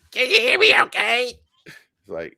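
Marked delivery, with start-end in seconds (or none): 0.1-1.3 high mimic voice. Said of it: "She's like".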